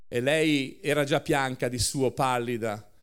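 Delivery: usual speaking voice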